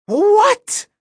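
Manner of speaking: very high, loud shocked what; shocked shriek. Acted. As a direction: squeal in total disbelief. Reader shocked.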